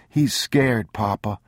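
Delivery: put-on high child's voice; pleading boy. A narrator's reading. Boy pleading for the scared old man, high child voice. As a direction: plead gently with Papa.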